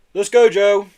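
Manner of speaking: lorry driver shouting to hurry. A narrator's reading. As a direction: call out from the lorry cab. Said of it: "shouted Errol".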